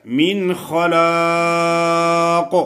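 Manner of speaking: من خلاق with a clean ن and a thick, drawn-out خ; clear nun, heavy kha. Shown as a demonstration. Teacher shows izhar in من خلاق. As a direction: sound the ن in من خلاق clearly, no nasal. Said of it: "اظہار کرنا ہے".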